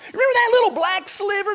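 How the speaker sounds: high, bright-eyed pretend saint, a little far-off; wistful voice from heaven. Preacher voicing a saint in heaven, awed and high. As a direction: voice a believer gazing down at earth in awe.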